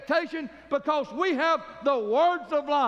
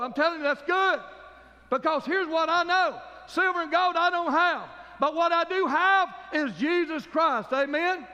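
full-force preaching; 4 semitones higher than usual. Real, not acted. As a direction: drive it home to the congregation. Preaching climax.

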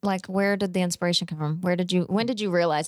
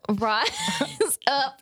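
breaking into giggles mid-attempt at an Aussie accent; high laughing Aussie try; 6 semitones higher than usual. Shown as a demonstration. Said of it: "I can't".

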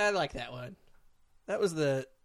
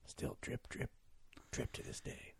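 whispering; 3 semitones lower, 14 dB quieter than usual. Retold or imitated. Ghostly dripping story.

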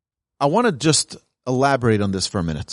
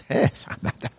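mock whisper; 5 dB quieter than usual. False-modest answer acted out.

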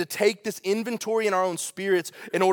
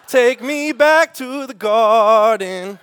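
mock lament; 5 semitones higher, 8 dB louder, much slower than usual. Joking plea, dragged out.

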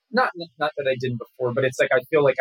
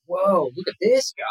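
higher-pitched, impressed reaction; amazed discovery; 6 semitones higher than usual. Acted. Actor imitating his own reaction after hours of research, amazed. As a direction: react with a mild, acted wow.

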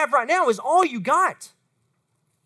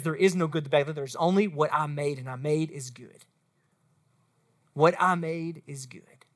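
much lower, softer voice; hushed, low, settled assurance; tender reassurance; 9 semitones lower, 6 dB quieter than usual. Acted. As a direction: drop down low and quiet to reassure. God reassuring a self-doubting student.

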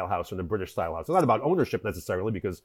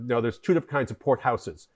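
ordinary talk, nothing marked

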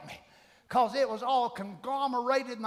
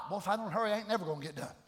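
ordinary delivery

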